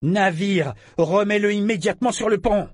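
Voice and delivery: loud, high, commanding shout; roared order. A narrator's reading. Captain ordering the ship to return the prisoner, roaring. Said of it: "rugit Brachen".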